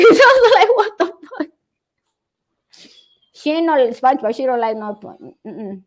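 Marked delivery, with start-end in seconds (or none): 0.1-1.5 high-pitched, laughing mock shock; laughing disbelief. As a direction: retell your shock through laughter. Laughing at her retold shocked reaction.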